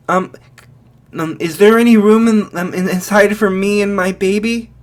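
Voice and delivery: a frightened mother asking to be let in; mother with her baby begging to be let inside, anxious and high. Acted.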